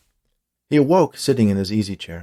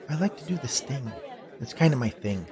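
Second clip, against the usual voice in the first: higher, lighter female character voice; softer, pitched-up bee girl; 4 semitones higher, 4 dB quieter than usual. A narrator's reading.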